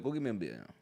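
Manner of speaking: low, soft at-home voice; Saquon Barkley. Acted. Player at home asking for a beer, lightly acted low voice. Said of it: "I've been waiting to talk like this".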